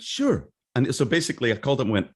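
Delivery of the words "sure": high bright "Sure" for a client; mock-professional high reply; fake business voice. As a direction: sound like you're taking a client call. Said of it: "And I went".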